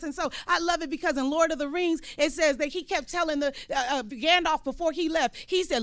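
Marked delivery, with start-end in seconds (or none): none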